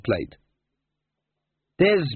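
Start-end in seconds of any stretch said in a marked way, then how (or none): 1.8-2.1 accusing. Acted. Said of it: "he said accusingly".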